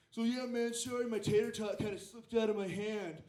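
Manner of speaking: smug fake apology from a cocky kid. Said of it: "he's all".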